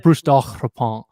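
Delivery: Daurepan with a throaty French r and nasal final vowel; French pronunciation. Shown as a demonstration. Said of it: "Like, I'll say that".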